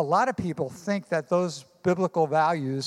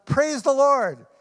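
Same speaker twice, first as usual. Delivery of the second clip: triumphant shout; 7 semitones higher, 4 dB louder than usual. Acted. Old lady answering the atheist's boast, triumphant shout. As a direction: crow with victory, arms flung up.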